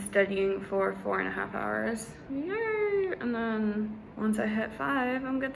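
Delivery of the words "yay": excited. Real, not acted. Cheering at study hours.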